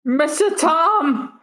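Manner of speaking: high, slow drawn-out call; anguished cry. Acted. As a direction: wail his name as he goes down. Apprentice cries as Tom falls.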